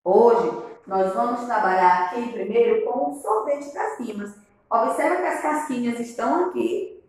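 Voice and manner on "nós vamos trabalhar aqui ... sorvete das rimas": ordinary talk, nothing marked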